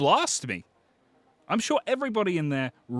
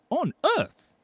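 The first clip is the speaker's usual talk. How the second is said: high mock squeak; 11 semitones higher than usual. Streamer teasing a viewer in a squeaky put-on voice. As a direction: jump much higher in a teasing squeal.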